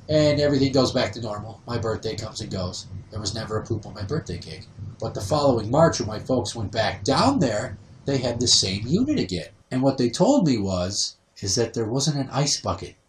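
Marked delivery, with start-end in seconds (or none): none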